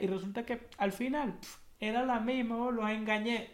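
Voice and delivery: high, squeaky mocking voice; mock them as bragging fakes; mocking the researchers. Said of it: "literalmente fue así, como que".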